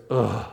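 low, heavy groan; a quiet groan offered up as prayer; prayerful groan. Shown as a demonstration. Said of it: "the best prayer we ever pray is".

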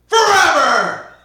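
very high, loud crying shout; anguished shout. Acted. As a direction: wail the last word. Shouted parting line.

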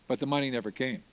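normal talking voice

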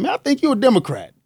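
retold accusation. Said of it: "he called me to the carpet and said".

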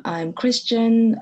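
ordinary talk, nothing marked

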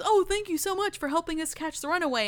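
high, quick put-on gratitude; gushing official. Guard thanking Katara for catching the runaway, gushing.

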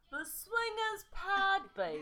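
put-on slow, high Liverpool accent; bad Liverpool accent. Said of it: "in, like, a Beatles accent".

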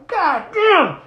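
high, loud swearing; re-enacted pained curse. Retelling his reaction to the hit, loud high curse. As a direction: yell the curse as if smacked.

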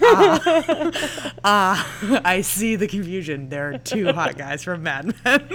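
laughing. Retold or imitated. Laughing reply to mother.

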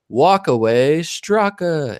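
slow, drawn-out stadium name call; baseball announcer. Shown as a demonstration. Host introducing his guest, drawn out like a baseball announcer. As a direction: stretch the name out like you're introducing a player at the ballpark. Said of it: "If I was a baseball announcer".

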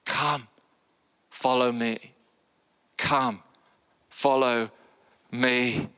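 a hint of Jesus speaking tenderly; voicing Jesus. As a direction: gently call them to follow. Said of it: "But I, Jesus".

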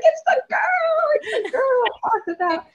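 high-pitched excited squeal; fangirl freak-out. Retold or imitated. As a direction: re-enact a starstruck freak-out at full pitch. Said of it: "I was freaking out".

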